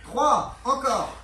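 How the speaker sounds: loud encouraging count; coach shouting reps. Real, not acted. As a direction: shout the count to push them.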